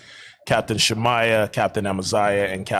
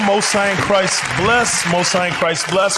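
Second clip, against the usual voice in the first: radio host intro; 8 semitones higher, 3 dB louder than usual. Acted. Radio show opening call.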